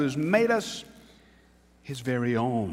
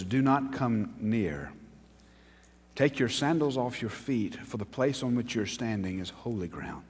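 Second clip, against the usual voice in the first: scripture reading; 4 dB quieter than usual. Acted. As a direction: read it as God speaking from the fire. Preacher reads God's words.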